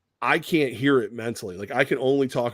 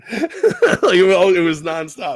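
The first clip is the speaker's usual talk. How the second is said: laughing; 6 semitones higher, 9 dB louder, slower than usual. Real, not acted. Laughing after the story.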